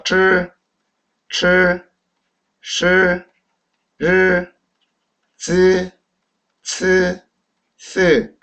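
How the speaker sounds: 출, 시, 르 with curled-tongue sound; each syllable sustained on a level pitch; retroflex pronunciation drill. Shown as a demonstration. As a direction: recite Mandarin initials one by one, 즈, 츠, 스 last. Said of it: "권설음 다음에 아이는 으다".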